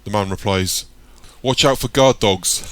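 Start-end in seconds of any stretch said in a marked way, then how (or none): 1.4-2.6 loud slow mocking warning; taunting shout. Gang member teases Michael about dogs, slow loud jeer. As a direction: call it out slowly, teasing him.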